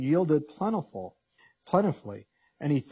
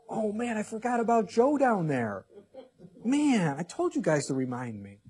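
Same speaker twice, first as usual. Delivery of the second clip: forgetful God; 6 semitones higher than usual. Acted. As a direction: play a mock-flustered God who forgot someone. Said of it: "You think God's going".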